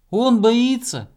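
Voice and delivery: high relaying call; squirrel shouting. A narrator's reading. Squirrel relaying hare's fear below, high shout. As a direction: shout it down from the star. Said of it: "крикнула белка".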